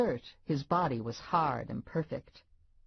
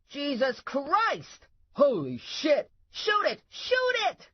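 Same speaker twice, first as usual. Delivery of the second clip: high, frantic shouts climbing higher; panicked shouting; 12 semitones higher, 3 dB louder, slower than usual. Acted. Cops finding snakes in the closet, panicked high shouting. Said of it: "they both started shouting".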